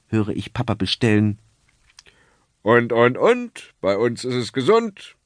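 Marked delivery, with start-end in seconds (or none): 2.6-5.2 a cheery showman selling tomatoes; jolly snack-bar hawker. A narrator's reading. Snack-bar owner answers the father, jolly hawker. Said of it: "antwortet die Stimme".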